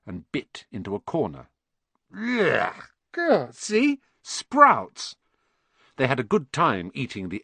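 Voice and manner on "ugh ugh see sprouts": high yuck of revulsion, then vindicated; disgusted. Acted. Ron biting a sprout-flavoured bean, disgusted. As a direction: spit out a horrible taste.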